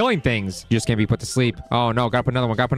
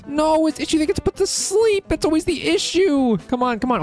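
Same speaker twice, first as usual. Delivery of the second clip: frustrated squeal; 13 semitones higher than usual. Real, not acted. Frustrated outburst at game.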